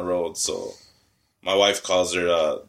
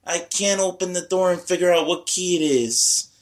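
higher, fussy complaining grandma voice; imitating mom; 9 semitones higher than usual. Grandma at the locked door, mock fussy complaint. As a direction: whine like a flustered grandma at the door. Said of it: "And she's like".

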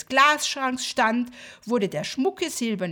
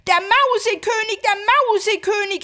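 high, loud panicked cry; terrified cry; 9 semitones higher, 8 dB louder, faster than usual. Acted. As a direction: shout it in horror. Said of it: "rief Marie und sprang voll Entsetzen".

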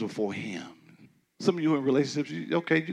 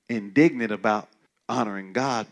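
ordinary talk, nothing marked

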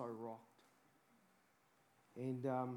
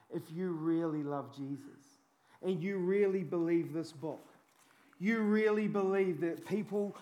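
ordinary talk

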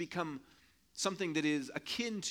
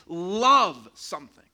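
emphatic preaching burst; 10 dB louder, slower than usual. Acted. Preacher stressing love.

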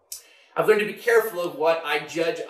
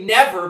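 a firm, insistent "never"; emphatic never; 2 semitones higher, 7 dB louder than usual. Retold or imitated. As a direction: punch the word "never" with conviction. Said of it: "It's an emphatic never".

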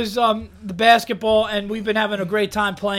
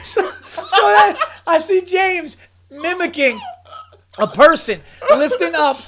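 higher voice, words squeezed through laughs; crying with laughter; 5 semitones higher, 3 dB louder than usual. Real, not acted. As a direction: fight through laughter to narrate. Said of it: "I am crying".

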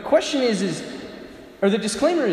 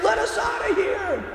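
panicked scream. Acted. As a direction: beg to be let out. Kids trapped with rattlesnakes.

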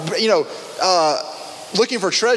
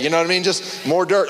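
usual speaking voice

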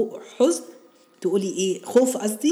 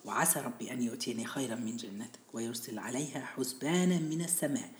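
whispered recitation; 4 semitones lower, 11 dB quieter than usual. Acted. Reciting the verse.

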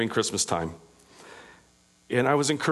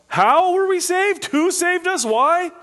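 very high, loud exultant cry; inward yell; 8 dB louder, slower than usual. Pretend. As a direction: shout the questions out in awe. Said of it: "we inwardly yell".

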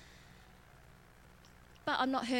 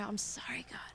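hushed, whispered sorry; a quiet whispered apology to God; whispered confession; 2 semitones lower, 8 dB quieter than usual. Pretend. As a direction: breathe it out as a prayer. Repentant prayer to God.

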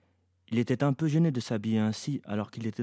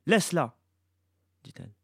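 raised, louder, firm order; urgent command; 4 semitones higher, 3 dB louder than usual. A narrator's reading. Mother forbids Iblis to follow, with urgent firmness. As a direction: stop him with a firm word.

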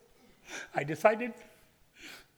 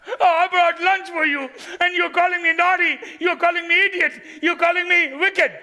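much higher, loud hurt outrage; indignant protest; 12 semitones higher, 9 dB louder than usual. Acted. David protests to his brother Eliab, loud and indignant. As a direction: fling it back at your brother.

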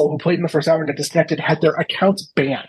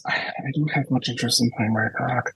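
hushed aside; whispering; 5 semitones lower, 6 dB quieter than usual. Real, not acted. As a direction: drop to a whisper. Confiding aside about the game.

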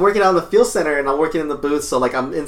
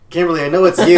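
louder, higher gotcha call; calling her out; 4 semitones higher, 6 dB louder, faster than usual. Retold or imitated. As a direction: catch the sneaky kid out loud. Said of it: "I was like".

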